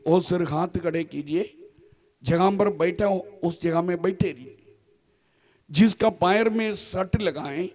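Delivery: healing ministry. Real, not acted. Preacher asks the healed to raise hands, hushed low prayer leading. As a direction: lead the healing quietly from the front.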